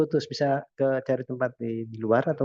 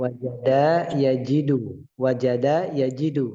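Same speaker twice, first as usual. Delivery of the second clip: slow demonstration; slower than usual. Shown as a demonstration. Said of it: "teacher models the verb slowly".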